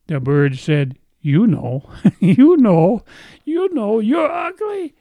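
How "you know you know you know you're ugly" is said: climbing into a very high squawky parrot voice, laughing; rising parrot squawk breaking into a laugh; parrot punchline. Acted. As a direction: tease it out, going higher and higher.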